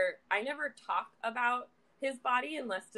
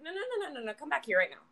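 high, rapid-fire retold protest; high string of refusals, then firmer; urgent calling back; 6 semitones higher, 3 dB quieter, faster than usual.

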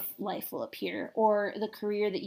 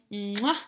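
exaggerated "mwah" kiss; a loud mwah; loud kiss sound; 5 dB louder than usual. Real, not acted. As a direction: blow the listeners a big smacking kiss. Blowing a sign-off kiss.

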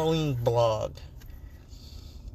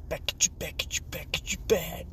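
breathy unvoiced whisper; 3 semitones higher, 4 dB quieter, faster than usual. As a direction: whisper it like an echo. Whispered echo.

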